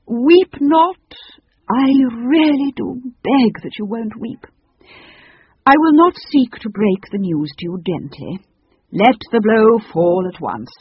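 loud, theatrical oratory, slowing at the end; grand proclamation. Acted. Phoenix breaking news of its departure to children, declamatory. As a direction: declaim a solemn farewell.

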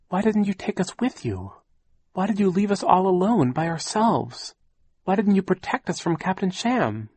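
put-on high-pitched reproachful boy; pleading child. A narrator's reading. Nephew asking aunt why she left them, pleading.

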